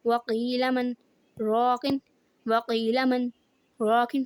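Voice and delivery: recited twice with a held stop after مَنْ; sakta pause. Shown as a demonstration. Teacher recites the sakta twice for her students. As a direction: stop the voice after مَنْ and carry on to رَاقٍ without a breath. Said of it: "چلے ہم پڑھتے ہیں".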